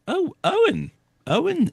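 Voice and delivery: pleasantly surprised. Real, not acted. Spots a regular in chat.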